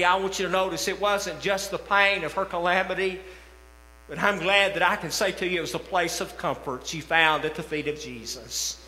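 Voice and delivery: ordinary talk, nothing marked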